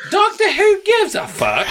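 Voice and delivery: shrieky cartoon Brit; mock British. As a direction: squawk it in a silly British falsetto. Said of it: "most British sounding shit".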